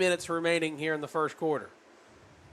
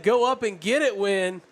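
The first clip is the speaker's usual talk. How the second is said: urging; 4 semitones higher, 6 dB louder than usual. Retold or imitated. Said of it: "Sullivan just said".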